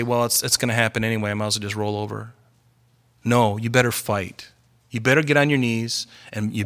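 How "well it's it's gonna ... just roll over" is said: fast, low, quiet defeatist mumble; a lightly voiced resigned believer; resigned shrug. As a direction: toss it off like giving up. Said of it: "his people should ... just quit and say".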